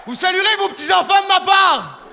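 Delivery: high, loud mock call to the elderly; teasing shout. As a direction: holler it at someone very old. Calling out to old-fashioned audience.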